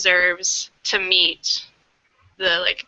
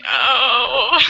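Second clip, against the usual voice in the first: touched; 5 semitones higher, 4 dB louder than usual. Retold or imitated. Touched by tweet.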